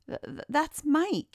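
high, flustered stutter; stammering. A narrator's reading. Mike correcting his old tormentors, stammering. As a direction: stumble on the first sound before getting it out. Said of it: "he stammered".

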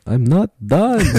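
high, loud, slow vampire protest; drawn-out cheesy vampire cry; Count Hacula. Acted. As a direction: refuse to leave the stage as a hammed-up vampire. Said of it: "I'd be like".

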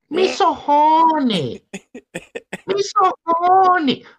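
very high, slow, drawn-out falsetto; mock the movie line in a squeaky high voice; hammed-up high-pitched movie impression; Full Metal Jacket hooker quote. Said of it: "The prostitute in Full Metal Jacket".

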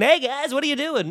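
high-pitched mock greeting; put-on high voice of an unsuspecting friend; oblivious newcomer. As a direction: imitate someone wandering into the group. Voices the person walking up.

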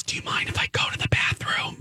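hushed whisper in a dark theater. Retold or imitated. Mom leaning over to ask her daughter, whispered. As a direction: lean over and whisper the request. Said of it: "She goes".

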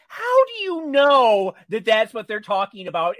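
loud, high, incredulous challenge; mock indignation. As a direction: burst out in playful disbelief at the narrator. Mock challenge to the narrator's claims.

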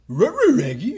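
stuttering, high cartoon dog; playful cartoon dog impression; Scooby-Doo. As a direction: garble it like a goofy talking dog. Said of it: "Scooby-Doo?".

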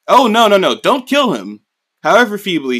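king urgently stopping the torturer from killing; urgent refusal. Retold or imitated. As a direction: stop him quickly before he kills.

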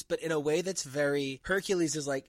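ordinary talk

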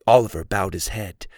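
usual speaking voice

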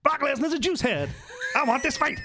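high, shouted American call-out; hammed-up preacher-style fighter challenge; church preacher. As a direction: proclaim it like a fired-up preacher. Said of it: "You sound like a preacher from church".

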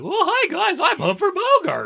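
loud, high-pitched cartoonish actor voice; Humphrey Bogart. Joking about the actor doing voices, hammed-up loud character voice. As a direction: belt out a silly, hammed-up star introduction. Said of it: "had to do a voice as an actor".

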